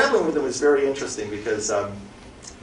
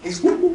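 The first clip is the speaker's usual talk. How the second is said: high whooping monkey call; monkey whoops; 4 dB louder than usual. Retold or imitated. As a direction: whoop like an excited monkey, much higher. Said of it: "the monkey starts whooping".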